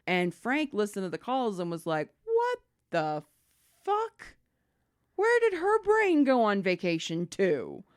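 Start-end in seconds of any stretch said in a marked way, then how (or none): 2.3-7.8 shocked disbelief. Retold or imitated. Supervisor's shocked reaction.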